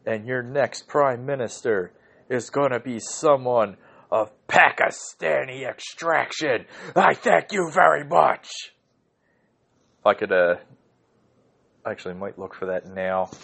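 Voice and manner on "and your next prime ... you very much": lightly voiced slow announcer read; a hint of a pompous wrestling boss; Vince McMahon impression. Host quoting Vince McMahon, a light pompous take. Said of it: "He said".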